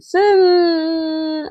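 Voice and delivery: long high sing-song "Sand"; drawn-out high call. Acted. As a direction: stretch it out high. Drawn-out announcing choice.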